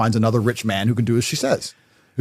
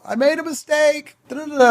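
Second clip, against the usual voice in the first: mock crying daughter; 13 semitones higher than usual. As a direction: whimper it like a daughter in tears. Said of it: "she'll be crying her eyes out".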